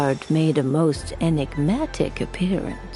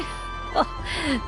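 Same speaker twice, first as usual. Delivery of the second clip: breathy chuckle; 9 semitones higher, 5 dB quieter than usual. Acted. Elder amused by youthful trends.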